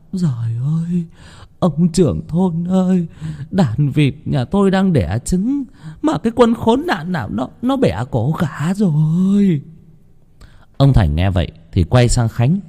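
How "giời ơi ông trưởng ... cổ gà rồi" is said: put-on high, weepy old woman's wail; tearful old woman. A narrator's reading. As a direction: complain through tears to the village chief. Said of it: "Bà cụ Thúy lau nước mắt". (bà cụ Thúy vừa khóc vừa kể, giọng cao)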